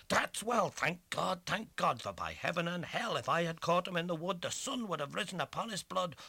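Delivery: fierce high outburst; furious, high and loud. A narrator's reading. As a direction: spit the threat out hot. Furious threat at rival.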